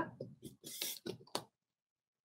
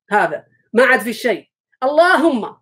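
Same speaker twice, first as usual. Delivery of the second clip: ordinary delivery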